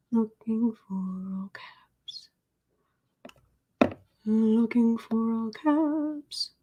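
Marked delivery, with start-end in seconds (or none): 0.1-2.3 slow whispered murmur while searching. Shown as a demonstration. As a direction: breathe it out softly, unvoiced and slow. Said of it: "muttering search in whisper".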